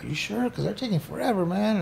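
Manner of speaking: nervous, needing reassurance; anxious doubt. Retold or imitated. Inmate asking his lawyer during deliberation, anxious. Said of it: "And I was like".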